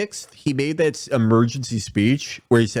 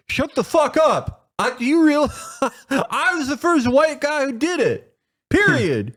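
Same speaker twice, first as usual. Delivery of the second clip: cartoonish presidential bluster; mock Biden rant; 11 semitones higher than usual. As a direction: snap then boast, much higher. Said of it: "He was like".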